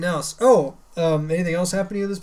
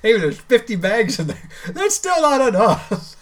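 mock fretting wife; 6 semitones higher, 7 dB louder than usual. Imitating wife, laughing.

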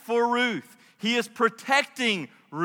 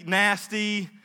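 usual speaking voice

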